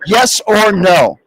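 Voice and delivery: drawn-out demand, slowly. Shown as a demonstration. Host to caller, slowly demanding yes or no. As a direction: press him slowly for an answer. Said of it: "go slow for you".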